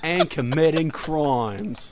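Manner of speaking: hammed-up Australian with a laugh in it; Australian accent. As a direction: put on a drawn-out Aussie accent. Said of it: "my best Australian accent".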